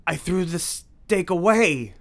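slow gasping disbelief, high. A narrator's reading. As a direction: gasp out the words, stunned. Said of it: "he gasped".

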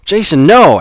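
high, loud warning shout. Acted. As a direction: yell to stop him. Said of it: "Alex shouted".

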